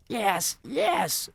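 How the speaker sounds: high, loud witch shout. Acted. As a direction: insist loudly. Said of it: "shouted the first witch".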